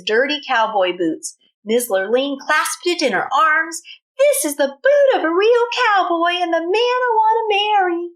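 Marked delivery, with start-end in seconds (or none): 4.2-8.1 higher, swooning vow; excited declaration. Acted. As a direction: proclaim your true love. Lurleen declares love for boot.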